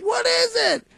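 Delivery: high, sharp, fed-up squeak of annoyance; like your patience just snapped; exasperated. Retold or imitated. Annoyed worker to the coworker who drives them crazy, exasperated high squeak.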